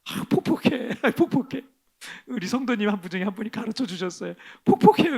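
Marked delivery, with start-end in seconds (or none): 0.0-1.6 전라도 사투리로 답답한 한숨; Jeolla dialect. Retold or imitated. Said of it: "전라도 사투리를 그걸 폭폭해라 그러더라고요". 4.7-5.2 much higher, loud frustrated cry; loud Jeolla-style wail of frustration; exasperated outcry. Real, not acted. Exclaims dialect word in frustration.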